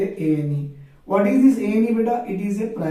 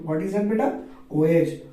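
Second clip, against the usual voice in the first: ordinary talk, nothing marked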